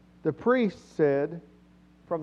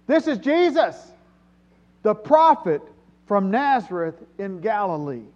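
high, loud proclamation, easing toward the end; loud crowd answer; 4 semitones higher, 6 dB louder than usual. Retold or imitated. Preacher voicing the crowd's reply, loud proclaiming. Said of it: "the crowds answered loudly".

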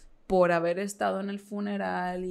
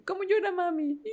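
put-on high, small voice of a toddler asking; little girl voice; 11 semitones higher than usual. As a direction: play the little daughter, curious and innocent. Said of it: "Y me dice". (voz aguda de niña pequeña preguntando)

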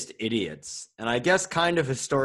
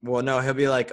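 usual speaking voice

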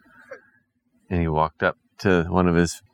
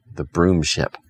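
usual speaking voice

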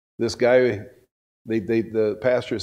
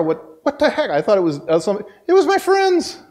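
high-pitched, incredulous outburst; exasperated, high and loud at the end; retold indignant shock; 9 semitones higher, 4 dB louder than usual. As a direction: cry it out in mock disbelief, voice leaping up. Retelling shock at friends' betrayal.

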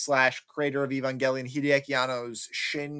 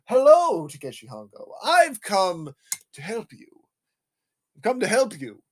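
imitated mad doctor; 4 semitones higher, 6 dB louder than usual. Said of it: "and he's like".

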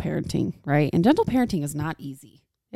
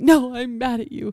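mock child, higher and a bit louder; imitating angry kid; 5 semitones higher, 3 dB louder than usual. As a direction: play the pouting kid lightly. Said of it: "If they're like".

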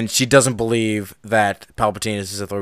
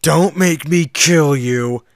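hammed-up threat, higher and drawn out; mock villain threat; 5 semitones higher, much slower than usual. Host mocks Anakin's threat to Obi-Wan, hammed-up villain. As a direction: stretch it slowly like a bad movie villain.

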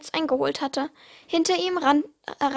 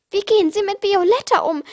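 higher, louder indignant complaint; complaining; 5 semitones higher, 6 dB louder than usual. Acted. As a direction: scold him for rough handling. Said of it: "beschwerte sich Larissa".

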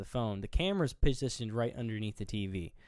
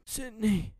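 high, strained mock dying call; imitated dying call; 11 semitones higher than usual. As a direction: call her name as the stabbed boyfriend collapsing. Stabbed Billy calls her name, collapsing.

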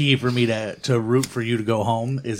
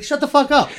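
exasperated retort. Retold or imitated. Exasperated retort to vegan contestants.

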